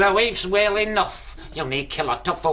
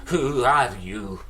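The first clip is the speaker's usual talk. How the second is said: ordinary talk, nothing marked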